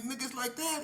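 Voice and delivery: high, loud rising challenge; can't believe he said no; incredulous retort. Retold or imitated. To K P after he refuses, incredulous high challenge. Retold quarrel.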